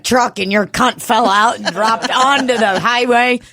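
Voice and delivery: high-pitched ad pitch; hammed-up, high advert questioning; mock commercial. Mock law firm commercial.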